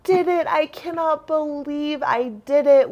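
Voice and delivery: high-pitched imitation of tearful disbelief; tearful triumph. Crying hiker realizing she made the pass, tearful triumph. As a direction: overcome and crying, as retold. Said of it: "she's crying".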